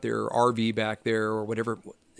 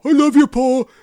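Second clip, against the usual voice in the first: much higher, louder, gushing [name] voice; [name] impression; 12 dB louder than usual. [name] telling [name] he loves him, gushing fanboy voice. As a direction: gush like an overwhelmed fanboy [name]. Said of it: "[name] looking at Paul".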